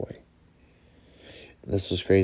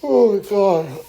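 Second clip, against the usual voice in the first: said through a big yawn; 13 semitones higher, 8 dB louder than usual. Real, not acted. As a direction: yawn the words out. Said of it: "Coach never yawns".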